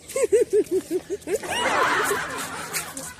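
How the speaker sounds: a bashful, high giggle; shy giggle. Real, not acted. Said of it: "कैसे हंस रही है". (शरमाती हंसी)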